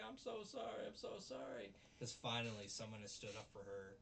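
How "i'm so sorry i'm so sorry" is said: very high, imitated crying voice; mock tearful apology. Miyabi apologises to Onizuka, mock crying. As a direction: whimper it high, as a retold sob. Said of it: "she starts crying".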